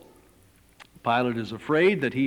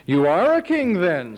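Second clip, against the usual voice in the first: loud incredulous Pilate; a louder, scoffing Pilate; Pilate, raised; 6 semitones higher, 6 dB louder than usual. Acted. Pilate scoffing at Jesus, loud and incredulous.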